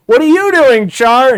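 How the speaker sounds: hammed-up meme outrage at Char; mock outrage. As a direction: yell the question in goofy disbelief.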